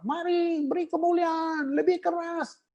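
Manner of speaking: mock worship leader. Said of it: "semua gereja gitu lah".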